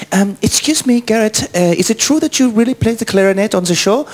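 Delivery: high-pitched, tentative fan question; imitated fan at microphone. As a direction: put on a high, polite fan voice. Voicing fan asking at microphone.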